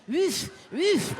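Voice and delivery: put-on dog barking with a French accent. Fancy shelter dog showing off its bark, French accent. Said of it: "barked with a French accent".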